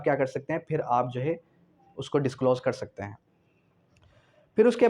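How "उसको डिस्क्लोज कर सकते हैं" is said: ordinary talk, nothing marked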